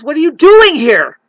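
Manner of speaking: loud, high challenge; angry shout. Real, not acted. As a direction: shout at them.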